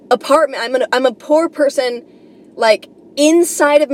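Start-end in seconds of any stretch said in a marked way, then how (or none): none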